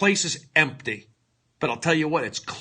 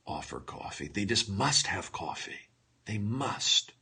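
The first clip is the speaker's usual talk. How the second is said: softer, hushed, heavy with mock feeling; hammed-up emotional scene; 4 semitones lower, 5 dB quieter than usual. Comedian retaking his emotional scene, mock tearful and hushed. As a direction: play the tearful confession about coffee. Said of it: "you're doing an emotional scene".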